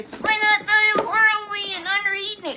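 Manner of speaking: high, loud triumphant proclamation; triumphant cry. Real, not acted. Preacher proclaims Elijah's ascent, high triumphant shout.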